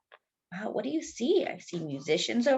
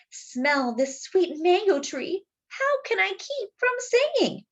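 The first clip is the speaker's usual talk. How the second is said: merry rooster; 9 semitones higher than usual. Acted. Rooster to the mayor, high and merry. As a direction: savour the mango and sing the question. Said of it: "the merry gallito".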